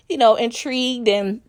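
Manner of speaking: normal talking voice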